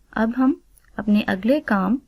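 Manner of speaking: ordinary talk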